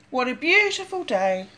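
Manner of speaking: ordinary delivery, nothing marked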